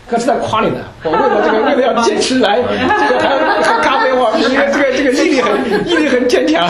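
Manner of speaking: real laughter while teasing a student; laughing tease. Real, not acted. Teasing praise of coffee-drinking student.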